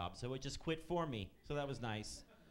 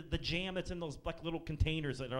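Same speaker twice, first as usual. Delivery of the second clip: ordinary talk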